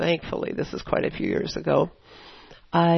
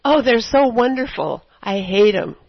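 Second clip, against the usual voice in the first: loud, higher-pitched mock gushing; imitated catty admiration; joking envy; 4 semitones higher, 8 dB louder than usual. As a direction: gush it, then land the catty jab. Quotes own joking jealous remarks.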